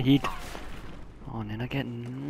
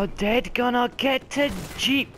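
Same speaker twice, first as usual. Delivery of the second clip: mocking taunt; 9 semitones higher than usual. Real, not acted. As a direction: taunt the enemy player. Mocking an opponent.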